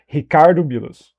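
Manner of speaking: Ricardo Milos with a throaty H for R; Brazilian pronunciation. Shown as a demonstration. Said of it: "That's Brazilian".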